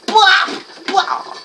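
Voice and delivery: sudden high, loud squeal. Real, not acted. Gamer striking with a knife, very high voice. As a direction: squeak it out loudly as you strike. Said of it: "my voice got that high when I said bop".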